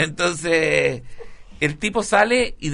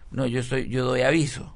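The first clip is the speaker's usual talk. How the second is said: usual speaking voice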